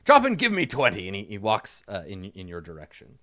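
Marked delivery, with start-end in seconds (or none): 0.0-1.1 loud, raised drill-sergeant order; sharp, loud exercise command; drill shout. Acted. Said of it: "he shouts it as the".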